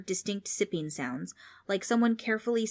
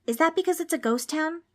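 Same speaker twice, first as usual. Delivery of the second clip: put-on much higher, louder kid voice; high-pitched innocent question; earnest boy Darren; 10 semitones higher, 5 dB louder than usual. A narrator's reading. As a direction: ask in all seriousness like a curious child. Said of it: "he was dead serious".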